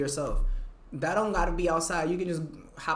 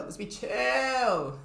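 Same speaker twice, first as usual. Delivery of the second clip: drawn-out plea; 6 semitones higher, much slower than usual. Acted.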